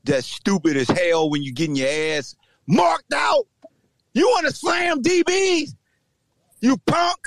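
shouting taunt. Real, not acted. Taunting the ejected lineman, shouting him down. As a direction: hurl it at him like a challenge. Taunting rival player loudly.

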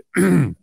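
sharp throat clearing. Real, not acted. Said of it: "I'm choking on whatever the hell's in this tea".

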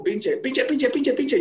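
high, repeated call for the ball. Retold or imitated. Player calling to his teammate for the pass, high repeated call. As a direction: chant pinche, pinche up high like asking for a pass. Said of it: "yo pedía la pelota como".

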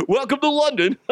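high, hammed-up greeting through laughter; laughing mock welcome. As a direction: crack up while playing the London welcomer. Laughing punchline in London host voice.

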